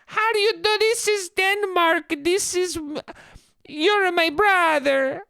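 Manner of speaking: high, drawn-out fake Danish falsetto; slow, incoherent Danish caricature; bad Danish accent. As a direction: ham up an absurd Danish squeal. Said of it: "I would do that accent".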